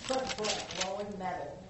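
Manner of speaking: loud, bold reading voice; big girl voice. Shown as a demonstration. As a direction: read it out big and confident. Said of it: "Read in your big, big girl voice".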